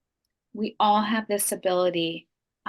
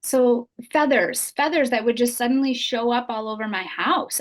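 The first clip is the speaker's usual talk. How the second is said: ordinary delivery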